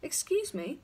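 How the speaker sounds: high, polite interruption; odd high voice, seeking attention; strange neighbour's voice. A narrator's reading. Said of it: "and said again".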